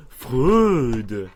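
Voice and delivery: "Freud!" stretched out and loud; very long Freud. Retold or imitated. Imitating Marc's cry of "Freud!", loud and very drawn out. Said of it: "Marc a fait un Freud très long".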